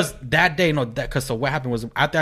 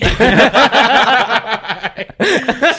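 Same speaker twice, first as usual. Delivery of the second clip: loud high burst of laughter; big laugh; 9 semitones higher, 7 dB louder than usual. Real, not acted. Laughing hard at his own club story, loud and high. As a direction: crack up at the punchline.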